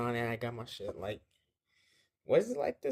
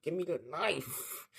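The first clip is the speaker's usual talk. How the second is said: straining demand; 6 semitones higher, 5 dB quieter, slower than usual. Retold or imitated. Mom wrestles knife away, retold.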